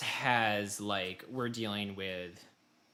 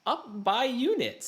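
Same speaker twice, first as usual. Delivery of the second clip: high, slow, odd-sounding prompt; a lightly put-on announcer reading a unit list; mock game prompt; 12 semitones higher, 4 dB louder, slower than usual. Host keeps voicing the campaign's instructions, high and slow. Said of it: "imitating the game prompt".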